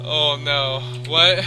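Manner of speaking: loud frightened cries; panicked. Real, not acted. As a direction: shaken after the scare. Right after the jump scare.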